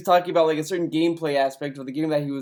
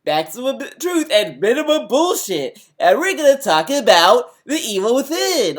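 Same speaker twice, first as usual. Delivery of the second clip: higher, loud exaggerated show-host intro; fake presenter voice; 6 semitones higher, 8 dB louder than usual. Mocking a game reviewer's intro, in a fake presenter voice. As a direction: put on a phony, booming reviewer's announcement. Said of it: "a presentator voice".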